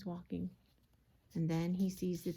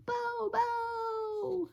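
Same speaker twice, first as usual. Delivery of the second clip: high, long mother's call; stretched, high-pitched holler; yelling a name; 3 dB louder than usual. A narrator's reading. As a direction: call out for your lost child. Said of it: "She's yelling his name".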